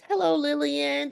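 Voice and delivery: high, gushing greeting; sweet Southern belle. Retold or imitated. Whitley greeting Rudy at the tea, high and gushing. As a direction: coo it like a beauty queen. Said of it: "she calls me Lillian".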